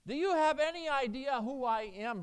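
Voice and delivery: loud, high, indignant demand; pompous passenger shouting at the gate agent. Acted. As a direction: bellow it so the whole line hears. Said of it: "he demanded in a voice loud enough".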